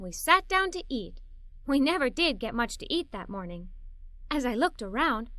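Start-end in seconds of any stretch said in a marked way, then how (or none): none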